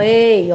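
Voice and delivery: "ei" with an open é; demonstrated open-mouthed "ei"; open sound. Shown as a demonstration. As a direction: show "ei" as an open vowel gliding to i. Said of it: "é a-, um som aberto".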